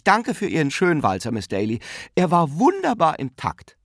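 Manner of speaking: put-on high, lively, slightly flustered old aunt; fussy hostess aunt. A narrator's reading. Aunt relieved, then thanking dancers for the waltz, fussing. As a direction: gush and bustle among the guests.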